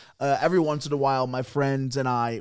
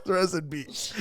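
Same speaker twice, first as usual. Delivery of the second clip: soft rest in peace through laughter; laughing mock eulogy; 3 semitones higher, 5 dB quieter than usual. Friend retelling his fake death caption, laughing. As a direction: laugh while quoting the caption. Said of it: "He says".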